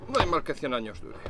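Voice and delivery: normal talking voice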